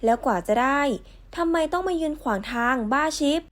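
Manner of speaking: ordinary talk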